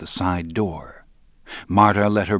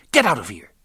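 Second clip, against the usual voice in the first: raised, louder order to leave; heated, higher-pitched send-off; angry dismissal; 4 semitones higher, 5 dB louder, faster than usual. A narrator's reading. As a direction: order them out, fed up. Marta throws her brother out.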